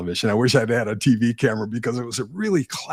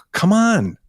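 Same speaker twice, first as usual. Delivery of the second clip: pitched high and loud, mock urging; exasperated come on; 12 semitones higher, 5 dB louder than usual. Speaker mimicking an impatient urge, high and loud. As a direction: toss it off like a frustrated plea.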